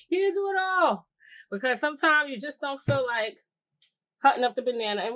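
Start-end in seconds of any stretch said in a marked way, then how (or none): 0.1-1.1 high, louder, knowing voice; emphatic sassy aside. Real, not acted. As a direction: tell the ladies straight, pitched up. Restated to the ladies after "excuse me.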